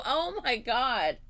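ordinary talk

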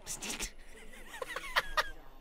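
high breathy gleeful cackle; gleeful laugh. Real, not acted. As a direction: cackle with delight at the kill. Gleeful laugh over kills.

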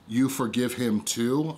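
ordinary talk, nothing marked

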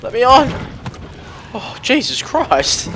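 high loud frantic yells; panicked shouting. Real, not acted. Panicking during game fight.

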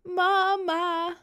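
high, drawn-out weepy call; whiny tearful little voice. A narrator's reading. Boy calling again for mum, tearful and pleading. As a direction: whimper it long and high. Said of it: "roept hij met een huilerig stemmetje".

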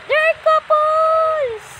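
high, slow, drawn-out excited cry rising to a shout; overjoyed. Acted. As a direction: squeal with joy at the deer couple. Sees more deer, overjoyed.

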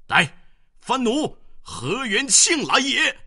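very high, loud war cry; mighty roar. A narrator's reading. General charging the enemy gate, roaring. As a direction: roar the challenge. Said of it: "大喝一声".

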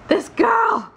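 put-on excited Scottish accent. Gushing about Jamie in a loud, high mock-Scottish shout. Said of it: "my Scottish accent is very good".

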